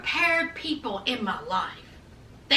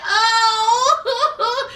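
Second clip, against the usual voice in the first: mock teary oh; 6 semitones higher than usual. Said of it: "She was like".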